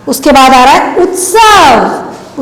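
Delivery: normal talking voice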